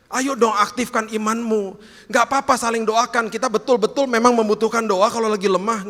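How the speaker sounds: fiery exhortation to the congregation; preaching at full force. Acted. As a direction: drive it home from the pulpit.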